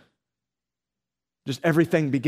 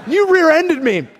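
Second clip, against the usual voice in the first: mock indignant yell; 12 semitones higher, 8 dB louder than usual. Other driver accuses.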